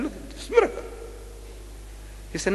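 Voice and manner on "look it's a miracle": high, mock-astonished exclaim; mock amazement. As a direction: pretend to be dazzled by it. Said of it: "I said".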